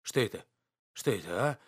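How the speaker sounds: low, halting questions with gaps between; stunned and breathless. A narrator's reading. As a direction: gasp it out, dazed after a slap. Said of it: "задыхаясь, говорил он". (ошеломлённо, задыхаясь)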